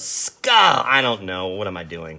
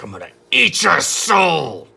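growled villain line, a bit higher and louder; monster voice; 5 semitones higher, 5 dB louder than usual. Retold or imitated. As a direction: play the menacing Hyde snarling his threat. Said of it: "He's like".

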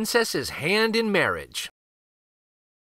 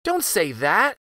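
higher, mildly upset protest; pitched-up plea to stop; protesting cry; 5 semitones higher than usual. A narrator's reading. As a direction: cry out in a child's defence. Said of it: "cried Marie".

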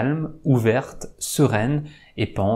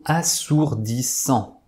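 "assourdissant" split into four separate beats; deliberately segmented "assourdissant", each syllable on its own; slower than usual. Shown as a demonstration. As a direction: say "assourdissant" with a short gap between syllables. Answer revealed syllable by syllable.

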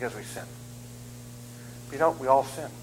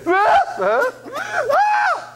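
high shouted mock wail, louder; mock crying wail; 6 dB louder, much slower than usual. Preacher crying back at the daycare kid, loud high mock wail. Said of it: "I cried exactly the way this".

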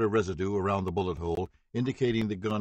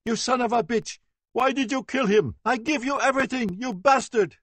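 very high, loud, screaming accusation; anguished screaming; 14 semitones higher, 6 dB louder than usual. A narrator's reading. Victim standing and screaming at the defendant, anguished. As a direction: scream it at him in grief and rage. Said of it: "She stood up, saying".

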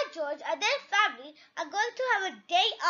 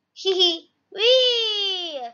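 loud giggle into a long wheee; gleeful cry; 7 dB louder, much slower than usual. Acted. George sliding down the Helter Skelter, gleeful cry. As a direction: squeal it out like a child on a slide. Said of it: "cries George".

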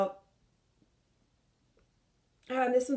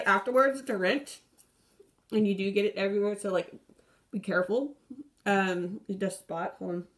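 toothbrush in mouth; talking around toothbrush; brushing teeth. Real, not acted. Seen 3: Green toothbrush in mouth, brushing throughout the labelled words.

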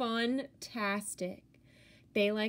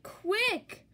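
urgent; 10 semitones higher than usual. Acted. Monsters rushing home at dawn.

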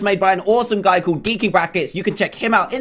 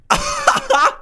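high-pitched laugh. Real, not acted. Gamer watching an opponent jump off, high laugh. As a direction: burst of high giggling.

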